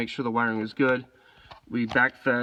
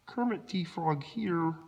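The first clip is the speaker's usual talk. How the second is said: high, slow, soft frog-puppet voice; Kermit the Frog; 6 semitones higher, 4 dB quieter, slower than usual. Acted.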